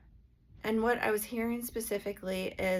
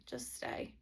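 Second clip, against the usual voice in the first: just stay whispered gently and low; soft whisper; 11 dB quieter than usual. Shown as a demonstration. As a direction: breathe it out like a quiet promise. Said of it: "That's what I always say".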